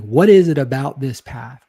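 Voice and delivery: ordinary delivery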